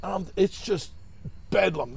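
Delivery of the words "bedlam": normal talking voice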